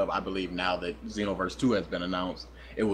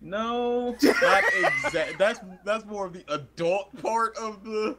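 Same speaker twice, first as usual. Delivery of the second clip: flustered denial; 11 semitones higher, 3 dB louder, slower than usual. Retold or imitated. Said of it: "I'm like".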